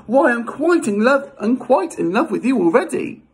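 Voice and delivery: high, smitten storybook heroine; high-pitched acted declaration of love; Leda's lovestruck coo. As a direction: gush in a high voice to a darling creature. Voicing Leda smitten with the swan.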